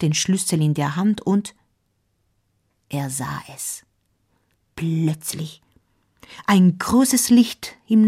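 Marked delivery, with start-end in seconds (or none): 2.9-5.6 low, soft storytelling hush; hushed suspense. A narrator's reading. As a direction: drop the voice for a moment of wonder. Hushed suspense as the narrator reveals the sighting.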